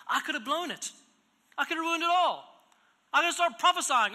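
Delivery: a preacher pressing the congregation, loud and high; full-force preaching. Acted. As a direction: drive the point home from the pulpit.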